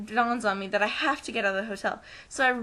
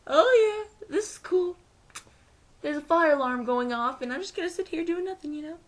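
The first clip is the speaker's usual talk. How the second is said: mock-breezy high voice, lightly acted; a hint of a careless lady; unbothered lady; 6 semitones higher than usual. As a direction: play the calm lady who couldn't care less. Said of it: "this lady's just sitting there".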